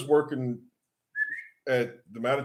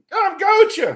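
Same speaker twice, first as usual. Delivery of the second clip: put-on much higher, loud Aussie accent; insistent repeat in a squeaky mock-Australian accent; imitated Australian kid repeating; 9 dB louder, much faster than usual. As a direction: push the Australian challenge up high and loud. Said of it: "the sort of leader of the, of the group looks at me and he goes".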